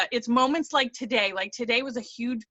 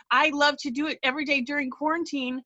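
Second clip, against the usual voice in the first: usual speaking voice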